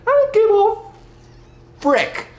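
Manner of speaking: sudden high loud outburst; mock blunt confession. INFJ behind closed doors dropping the nice act, loud and high. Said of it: "they'll say".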